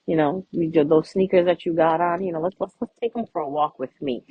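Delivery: low, smooth come-on voice; a low, slick pickup line; laying it on strong. Retold or imitated. To a crush, a low smooth come-on. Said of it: "you lay it on them strong".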